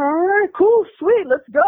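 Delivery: very high, excited exclamation; mock giddy celebration, pitch shooting up; acted-out glee. Thrilled they hopped in the car.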